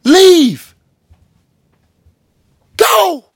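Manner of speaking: high, clipped one-word commands; a hammed-up leave; mock dismissal. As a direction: shoo them out in a high voice.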